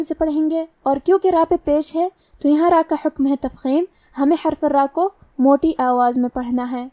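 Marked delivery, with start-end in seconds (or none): none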